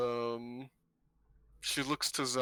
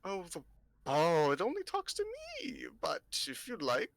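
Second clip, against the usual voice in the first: put-on coy old seer with swooping, higher pitch; mysterious old fortune-teller; 5 semitones higher than usual. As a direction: tease them like a knowing old lady.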